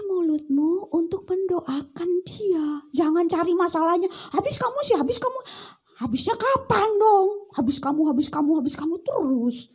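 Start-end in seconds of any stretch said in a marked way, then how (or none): 4.3-9.7 high, nagging blame; singsong reproach, higher; blaming nag. Acted. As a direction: keep jabbing the blame at them. Blaming a sick friend.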